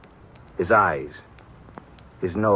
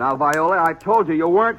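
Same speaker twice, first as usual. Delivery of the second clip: raised and higher, scolding his sister; stern rebuke; 9 semitones higher, 5 dB louder than usual. Acted. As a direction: put your foot down with her. Brother forbids sister going out.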